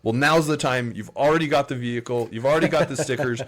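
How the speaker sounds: emphatic, building persuasion; insistent urging. Retold or imitated. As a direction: imitate an eager recruiter pressing the point. Retold recruiter urging him to join.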